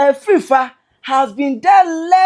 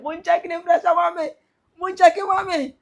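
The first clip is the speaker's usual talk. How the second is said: higher, sing-song mock of a man checking his pressure; mocking Kevin Taylor; 7 semitones higher than usual. Commentator mimics Kevin Taylor checking his pressure to mock him, high and whiny. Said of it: "Kevin Taylor".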